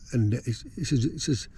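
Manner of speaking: usual speaking voice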